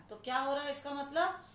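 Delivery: ordinary delivery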